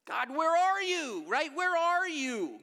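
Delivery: high, loud, slow wail; anguished cry to God. Acted. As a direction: call out to God as if abandoned. Voicing people crying to God.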